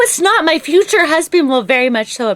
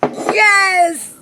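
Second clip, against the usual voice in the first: long, drawn-out cheer; yelling enthusiastically; much slower than usual. Real, not acted. Co-host cheering the Dracula topic, yelling keenly. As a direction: celebrate with a stretched yell. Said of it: "I don't know why we're yelling".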